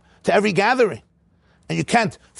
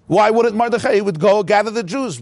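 ordinary delivery

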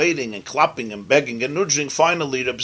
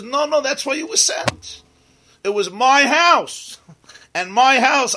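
higher and louder, slow on my house; firm insistence; 11 semitones higher, 3 dB louder, slower than usual. Acted. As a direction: lay down the rule of your own home. Rebbe asserts his house.

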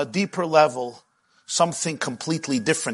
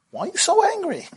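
mock whiny question; 13 semitones higher than usual. Mocking the annoying question.

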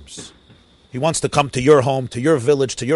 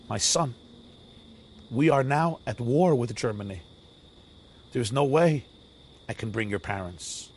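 soft, lowered voice, gently paused; compassionate king; 3 semitones lower, 6 dB quieter than usual. Retold or imitated. King tells a crying boy about the war, compassionate. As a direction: break hard news gently, sinking quieter. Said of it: "with eyes of compassion".

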